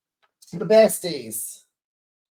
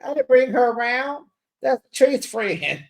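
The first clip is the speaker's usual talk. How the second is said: Glenn's defensive reply, voiced higher; a raised, pleading denial that it was him; defensive protest; 7 semitones higher, 3 dB louder than usual. Glenn insisting to Latrice it wasn't him, higher and defensive. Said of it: "So Glenn said".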